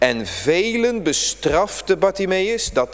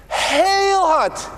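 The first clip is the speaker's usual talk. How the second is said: loud call; 11 semitones higher, 3 dB louder than usual. Acted. Bartimeus cries out very loudly.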